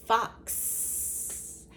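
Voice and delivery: Fox whispered, the x hissed; whispered Fox, hissing the x; whispered word. Shown as a demonstration. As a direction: breathe out Fox softly. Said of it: "box and fox".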